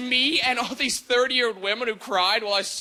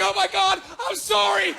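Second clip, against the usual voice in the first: higher, flustered gasp of an apology; mock freaked-out apology; 8 semitones higher than usual. Date panicking after hearing his mom died, flustered and higher. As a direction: play her panicking over a faux pas. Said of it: "she freaks out".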